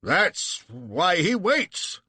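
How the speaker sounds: high, slow weighty line; quoted Sale. A narrator's reading. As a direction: deliver it gravely. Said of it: "says Sale".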